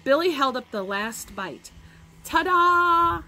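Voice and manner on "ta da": high, loud showman's flourish; triumphant ta-da. A narrator's reading. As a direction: present it proudly and loudly. Boy triumphantly shows last bite.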